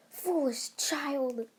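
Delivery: high, drawn-out villain scorn; scornful villain. Retold or imitated. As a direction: stretch it out like a mock villain. Villain's scornful retort in story.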